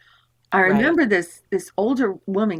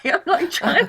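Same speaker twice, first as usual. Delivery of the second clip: laughing; 8 semitones higher than usual. Real, not acted. Laughing while telling.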